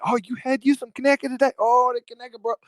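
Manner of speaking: high, fast put-on gushing voice; mock local bragger. Mocking how locals don't brag about Conecuh, high gushing put-on voice. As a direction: ham up a proud local fussing over sausage. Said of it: "We don't really walk around saying".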